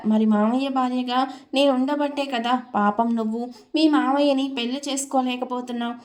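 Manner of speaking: ordinary delivery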